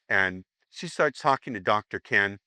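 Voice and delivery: ordinary talk, nothing marked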